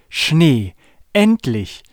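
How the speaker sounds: excited. Acted. Child cheers at snow.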